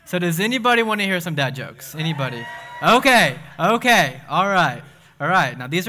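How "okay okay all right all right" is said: loud, rising hype to settle the crowd; excited. Real, not acted. Youth pastor hyping the crowd for dad jokes, loud and excited. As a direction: pump the room up before the jokes.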